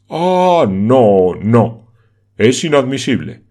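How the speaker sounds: loud, outraged protest; indignant no. Acted. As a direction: burst out in protest. Ursiclos widerspricht empört.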